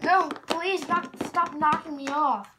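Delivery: high desperate plea; panicked plea. Acted. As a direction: beg them to stop. Character begs not knocked.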